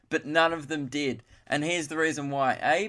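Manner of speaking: ordinary delivery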